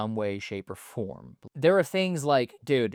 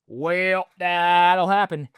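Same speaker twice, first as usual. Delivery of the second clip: higher and louder, slow, breezy; shrugging it off; 5 semitones higher, 7 dB louder, slower than usual. Retold or imitated. Vlogger showing how he shrugs off friends' teasing, high, loud and breezy. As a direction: toss it off like it's no big deal. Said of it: "I've just learned to be like".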